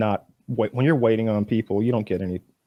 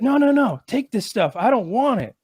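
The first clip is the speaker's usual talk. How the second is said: much higher, insistent cheater; high insisting voice; 10 semitones higher than usual. Retold or imitated. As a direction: push the gear on him. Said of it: "He's like".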